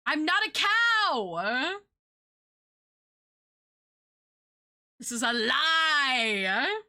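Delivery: drawn-out, high playful protest; slow, hammed-up denial; mock indignation. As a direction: stretch it out in pretend outrage. Mock protest at being called cow.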